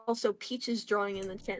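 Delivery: normal talking voice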